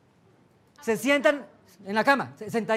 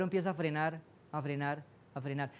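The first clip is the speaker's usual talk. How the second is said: fading away; 6 semitones lower, 8 dB quieter than usual. Shown as a demonstration. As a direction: voice sinking lower and softer to nothing. Slowing down. (frenando, cada vez más bajo y suave)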